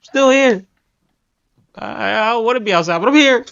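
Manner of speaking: mock reluctant little voice; accent peeking out. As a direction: play a hint of someone timidly poking out of hiding. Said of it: "peeking out from out the bushes like Homer".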